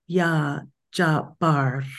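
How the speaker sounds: "Ya Jabar" said slowly, the R in "Jabar" lightly rolled and the B stressed; "Ya Jabar" slow and deliberate, a light rolled R at the end. Shown as a demonstration. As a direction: draw out "Ya Jabar", emphasis on "bar" with a little roll of the R. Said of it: "there's a little roll of the R".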